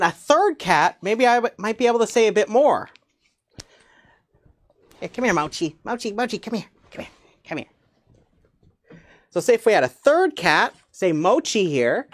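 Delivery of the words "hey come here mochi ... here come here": soft coaxing call to a pet; coaxing a cat. Real, not acted. Teacher softly coaxing her cat Mochi to come. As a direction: coax the cat over gently.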